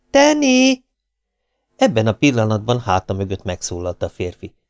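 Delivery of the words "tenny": high-pitched searching call. A narrator's reading. Maisie, getting no answer, calls out for Tenny. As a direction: throw the name out high. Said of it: "kiáltotta".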